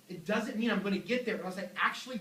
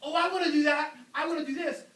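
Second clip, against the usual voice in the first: eager resolution maker; 8 semitones higher, 6 dB louder than usual. Retold or imitated. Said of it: "Because people say".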